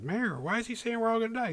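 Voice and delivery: put-on high childlike voice; kids asking. Kids asking the mayor, high childlike voice. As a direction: ask like a puzzled little kid. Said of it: "They're like".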